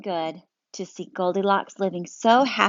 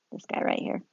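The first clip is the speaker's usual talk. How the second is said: ordinary delivery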